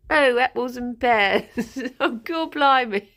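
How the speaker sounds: mock a bad Cockney accent, breaking into laughs; exaggerated Cockney. Mocking an actor's English accent, hammed-up Cockney. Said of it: "he just sounds really like".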